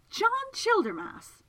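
very high, loud, drawn-out cry of the name; astonished exclamation. Acted. Miss Absalom recognises the man crashed on her floor, exclaiming. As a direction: exclaim it slowly, pitch leaping up.

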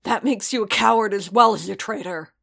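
loud, bitter accusation; spat out. A narrator's reading. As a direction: spit the insult at an enemy. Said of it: "Paz spat".

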